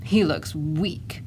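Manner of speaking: slow, low-key grumble; grumbling. A narrator's reading. Tyrannosaurus mother finding her baby weak, grumbling. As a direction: grumble it slowly, unimpressed. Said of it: "And she grumbled".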